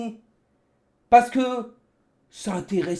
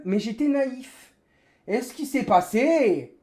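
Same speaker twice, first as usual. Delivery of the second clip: normal talking voice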